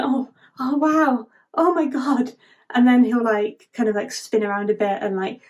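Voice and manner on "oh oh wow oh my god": acted wonder. Transformed star marvels at himself.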